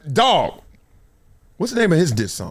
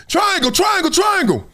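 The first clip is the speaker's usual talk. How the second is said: coach yelling; 14 semitones higher than usual. Retold or imitated. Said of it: "I just be looking like".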